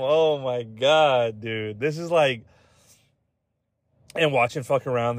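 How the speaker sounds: ordinary delivery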